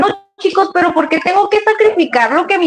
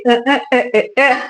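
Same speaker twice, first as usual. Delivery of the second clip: imitating dance beat. Shown as a demonstration. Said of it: "la Polet se hace".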